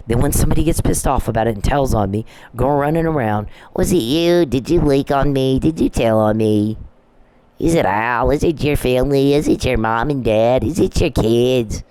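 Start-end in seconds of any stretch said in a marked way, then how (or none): 3.7-11.8 mock paranoid questioning. Mimicking harasser's paranoid questions.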